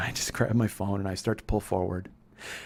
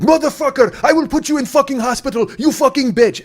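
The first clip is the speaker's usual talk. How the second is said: screaming with rage, pitched way up; furious yell; 12 dB louder than usual. Retold or imitated. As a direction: scream the threat at the top of your lungs. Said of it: "he jumps out of the car and he's like".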